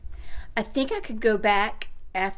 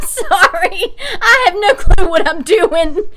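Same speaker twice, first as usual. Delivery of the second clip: laughing through the words; 10 semitones higher, 10 dB louder, slower than usual. Real, not acted. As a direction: giggle helplessly while apologizing. Laughing at herself.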